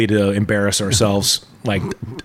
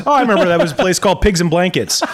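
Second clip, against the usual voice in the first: laughing; 7 semitones higher, faster than usual. Real, not acted. Laughing through quick joke.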